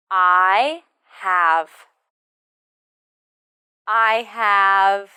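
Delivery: "I have" drawn out and held level; slow model. Shown as a demonstration. Teacher models the answer pattern, slow drill. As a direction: stretch "I have" evenly for repeating.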